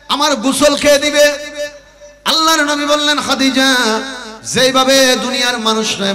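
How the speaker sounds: high, loud intoned sermon narration; high melodic preacher's delivery; full-force preaching. Acted. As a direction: chant the story out, easing down at the end.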